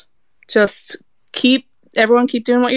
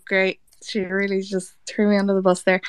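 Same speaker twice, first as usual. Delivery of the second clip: usual speaking voice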